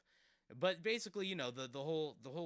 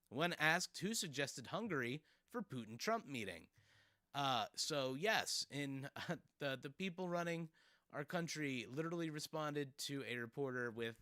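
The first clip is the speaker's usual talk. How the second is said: ordinary talk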